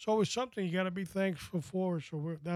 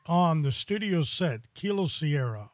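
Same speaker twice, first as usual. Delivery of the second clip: announcer; 5 dB louder than usual. Acted. Hosts hyping the guest's entrance.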